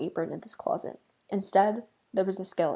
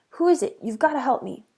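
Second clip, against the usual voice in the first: louder, higher frantic cry; panicked plea; 4 semitones higher, 7 dB louder than usual. Acted. Scared mouse pleading with his sister, louder frantic cry. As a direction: beg for help in a panic.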